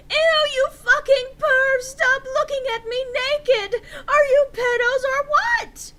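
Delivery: very high, loud, slow shriek with laughing sobs; hammed-up disgusted screech; screaming. A narrator's reading. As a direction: scream at the peeping pervs. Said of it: "I screamed".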